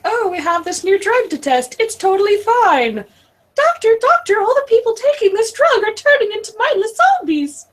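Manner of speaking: high, bright skit voice; mock scientist voice. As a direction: play a reckless drug company doctor. Acted pharma doctor skit.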